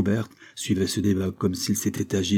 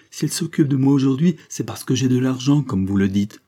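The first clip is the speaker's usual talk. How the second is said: heated, louder accusation; impetuous protest; 5 semitones higher, 5 dB louder than usual. A narrator's reading. Girl suspecting her mother wants money, protesting impetuously. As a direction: press the accusation, starting high and forceful. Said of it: "s'écria impétueusement Angèle".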